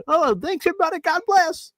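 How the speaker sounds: very high put-on voice thanking fans; hammed-up grateful goodbye to a crowd; squeaky mock acceptance speech; mock thankful farewell. Voicing Cena's farewell to the crowd.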